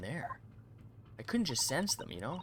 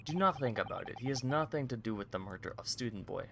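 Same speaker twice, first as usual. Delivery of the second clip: Russian sailor boasting. Acted. Guard brags about the VIP cabins, lightly Russian-accented. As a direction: show it off like a proud Russian guard.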